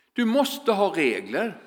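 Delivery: ordinary delivery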